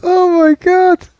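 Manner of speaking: high, loud, drawn out through laughter; helpless laughing. Real, not acted. Host overcome by the word anemone, helplessly laughing. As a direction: gasp it out while cracking up.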